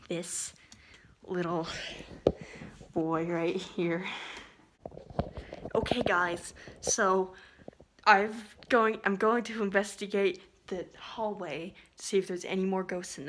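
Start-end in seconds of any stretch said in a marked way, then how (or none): none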